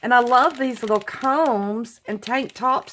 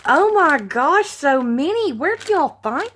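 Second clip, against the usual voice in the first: excited exclaim; 6 semitones higher, 5 dB louder than usual. Real, not acted. Overwhelmed by gifts.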